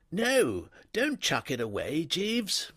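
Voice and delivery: put-on an easygoing young master stopping his valet; young toff. A narrator's reading. Wooster speaks to his valet.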